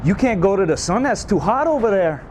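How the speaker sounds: high, scolding Cajun voice; Cajun accent. Pretend. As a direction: stammer then scold in Cajun. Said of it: "they say".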